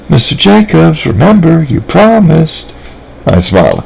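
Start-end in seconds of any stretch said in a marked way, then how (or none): 0.1-2.7 put-on high, sweet small-girl voice; Sally chatting innocently to the nice lady; little girl voice. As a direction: talk like a trusting little girl.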